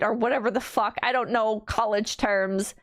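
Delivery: usual speaking voice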